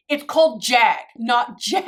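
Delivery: mock husband stressing "Jag" over "Jet"; husband correcting. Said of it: "And he was like".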